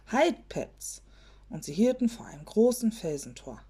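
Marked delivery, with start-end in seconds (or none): 0.1-1.0 higher-pitched short call to halt. A narrator's reading. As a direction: call the bear to a stop, pitched up. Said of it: "rief das Sandmännchen schon". (kurzer, heller Zuruf)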